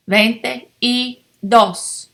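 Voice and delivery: slow, separated Veinte y dos with y stressed; teacher slows Veinte y dos to show its parts. Shown as a demonstration. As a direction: pull Veinte y dos apart, slowly. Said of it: "We're gonna say it slow right now".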